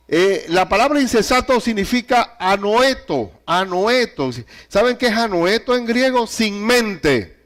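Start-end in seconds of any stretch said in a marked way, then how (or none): none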